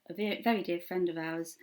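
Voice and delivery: ordinary talk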